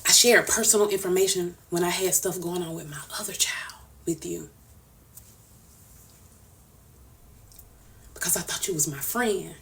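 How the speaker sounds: barely holding it together, voice sinking to a whisper; hurt, breaking down. Real, not acted. Telling Nitra she hurt her, in a hushed, hurt voice. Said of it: "I get emotional".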